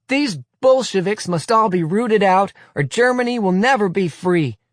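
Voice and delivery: high, loud pat slogan; glib shout. Acted. Fourth boy shouting slogan, glib loud shout. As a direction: shout it glibly like a learned slogan. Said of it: "shouts a fourth glibly and pat".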